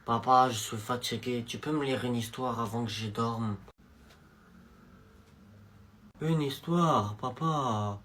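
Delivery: drowsy mumble for a story; child begging dad for a bedtime story, soft and low; sleepy child. Acted.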